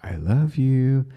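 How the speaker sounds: slow, high and loud; a sung-out, lifted I love you; drawn-out high I love you. Retold or imitated. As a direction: stretch it out up high. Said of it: "all these different ways to say".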